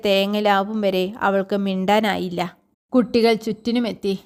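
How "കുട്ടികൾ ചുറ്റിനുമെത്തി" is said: usual speaking voice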